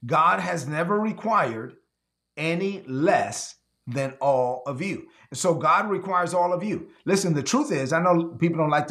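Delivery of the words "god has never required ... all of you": slow and lower, leaning on never; a slow, low second pass; said slow again. Shown as a demonstration. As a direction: repeat it slowly so it sinks in. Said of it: "Let me say that again".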